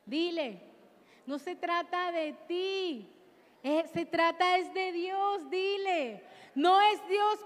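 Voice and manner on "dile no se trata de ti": usual speaking voice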